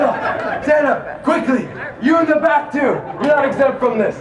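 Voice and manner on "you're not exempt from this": ordinary talk, nothing marked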